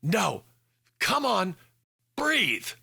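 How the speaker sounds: mild narrated scream, pitch climbing. A narrator's reading. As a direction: plead her to breathe, rising. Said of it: "Hannah screamed a few yards away".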